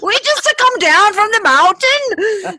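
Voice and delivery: high, hammed-up Lord of the Rings accent; badly faked accent, like a fantasy mountain dweller; mock fantasy accent. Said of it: "they're from, like, the Lord of the Rings".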